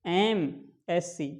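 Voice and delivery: एमएससी stretched very slowly, opening on an ए sound. Shown as a demonstration. Teacher to students, sounding out the start very slowly. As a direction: draw out एमएससी so the starting ए vowel rings. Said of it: "एम का उच्चारण करिए".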